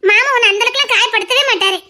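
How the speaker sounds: usual speaking voice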